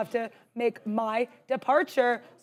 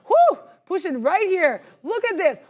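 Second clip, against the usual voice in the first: excited whoo; 5 semitones higher, 3 dB louder, slower than usual. Real, not acted. Cheering a viewer's trade.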